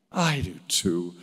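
normal talking voice

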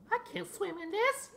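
very high-pitched put-on voice; high cartoonish complaint; squeaky creature voice. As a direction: voice a little game critter in falsetto. Voices a game creature, then answers it.

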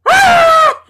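very high, very loud shriek; piercing imitated scream; mom's scream. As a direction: imitate a mother shrieking in shock. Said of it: "Don't fucking scream".

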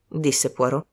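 plain reading voice

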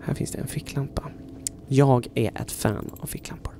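soft hushed whisper. Real, not acted. Exploring the silent room, whispering. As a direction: keep it to a whisper.

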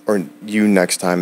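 usual speaking voice

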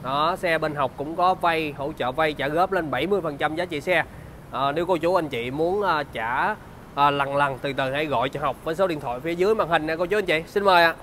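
bright, upbeat sales pitch; slick used car salesman. Acted.

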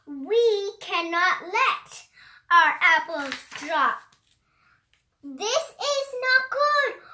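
high, lively storybook read-aloud; reading to children. Acted. A child reading a rhyming book aloud, high and animated. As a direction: bounce through the rhymes like a picture book.

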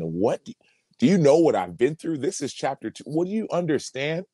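incredulous retort. Retold or imitated. Player answering his friend's chapter two remark, incredulous. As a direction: protest with pitch climbing on each question.

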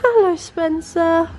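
tender first hello to the puppy; cooing to puppy. Real, not acted.

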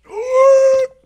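loud, high startled gasp; sharp gasp. Real, not acted. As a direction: gasp at a near death. Almost died in game.

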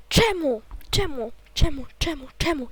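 loud, repeated outcry of why; exasperated protest. Real, not acted. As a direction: cry out in dismay, punching each repeat. Protests after failing in game. (głośno, z rozpaczą)

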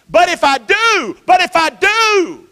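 high, slow, drawn-out Jesus voice; voicing Jesus. Preacher voicing Jesus to the congregation, high and drawn out. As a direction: stretch it out as Jesus pleading.

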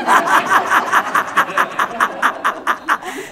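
dad's raucous cackle; loud guffaw. Acted. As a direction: burst of breathy laughter. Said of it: "Suelta una carcajada mi papá".

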